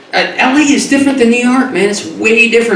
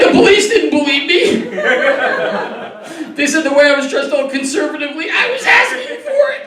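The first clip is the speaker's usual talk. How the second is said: mock victim; 7 semitones higher than usual. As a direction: stretch "used" out, wounded and high. Mock victim story continues.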